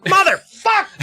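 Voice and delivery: high, loud burst of rage; furious curse. Retold or imitated. Brady curses at Susie after the trick fails, hammed-up rage. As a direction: explode at the ruined trick.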